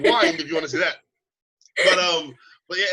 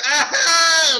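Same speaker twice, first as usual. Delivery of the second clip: loud, high mock scream; 9 semitones higher, 10 dB louder than usual. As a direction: let out a hammed-up "ah". Acting out swab reaction.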